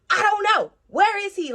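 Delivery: high, loud re-enacted outburst; panicked yelling. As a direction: yell it like a frantic parent searching. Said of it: "I'm panicking".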